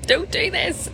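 high-pitched little warning voice; mock warning. Logical brain telling you to stop, high mock warning.

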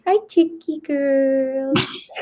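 put-on slow, soft sing-song to baby chicks; slow cooing baby talk to hens; high soft chicken voice. Shown as a demonstration. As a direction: coo at the chicks softly. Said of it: "talk in a high-pitched, soft voice like this".

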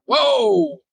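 long, very high whoa; retold amazement. Player recalling his draft letter, high-pitched amazed exclamation. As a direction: draw it out high in mock astonishment. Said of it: "I'm like".

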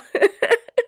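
high shaky laugh near crying; tearful laugh. Real, not acted. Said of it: "I'm trying not to cry".

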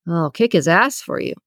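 mildly menacing tone; understated tough-guy menace. A narrator's reading. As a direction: deliver a half-serious threat. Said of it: "took on a menacing tone".

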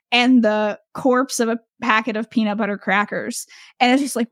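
ordinary delivery

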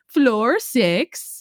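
put-on elevator voice in a Swedish accent. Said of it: "like, a Swedish, Swedish accent".

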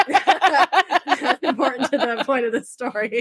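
slow words through laughter; laughing. Real, not acted. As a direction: laugh while explaining. Laughs through own aside.

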